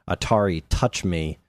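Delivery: ordinary talk, nothing marked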